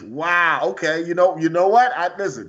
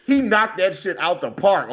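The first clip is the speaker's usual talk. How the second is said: usual speaking voice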